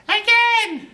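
put-on baby cheering after Bill falls off the stool; baby shouting. A narrator's reading. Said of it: "The baby laughs and shouts".

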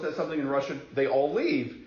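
ordinary delivery

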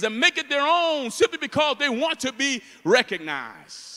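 loud, high-pitched sermon delivery; full-force preaching. Real, not acted. Preacher condemning hunger for recognition, at full force. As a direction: hammer each charge at the congregation, then let the close drop.

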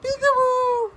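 very high sing-song peekaboo. Real, not acted. Playing peekaboo with baby.

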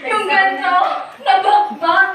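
bursts into laughter; real laugh into the words. Real, not acted. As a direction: laugh it out, then carry on. Laughing at the disaster.

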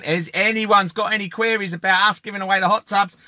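ordinary talk

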